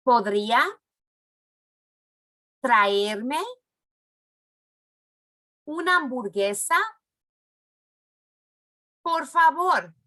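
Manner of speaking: each word alone, pausing for the class to repeat; spaced out model phrase, rising at the end; slow word by word. Shown as a demonstration. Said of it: "When I go like this, that means it's your turn".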